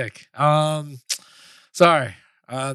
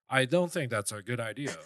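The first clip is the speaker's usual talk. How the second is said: a touch lower and softer, measured Spock-like delivery; light Spock impression; 4 semitones lower, 8 dB quieter than usual. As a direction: lightly imitate Spock's reasoned objection. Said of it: "he's like Chinese Spock from".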